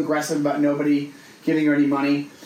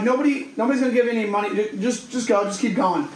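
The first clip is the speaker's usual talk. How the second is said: urging her on; 6 semitones higher than usual. Retold or imitated. Telling the woman to move on.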